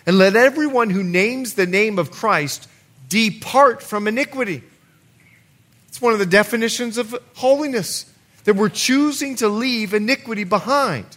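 loud, high preaching of scripture; fervent pulpit preaching. Acted. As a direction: proclaim it to the congregation. Preaching scripture at full force.

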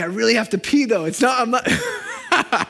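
high, loud, laughing whine; mock desperate listener. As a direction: play a squirming churchgoer who needs the toilet, slowly. Voicing an audience member needing to pee.

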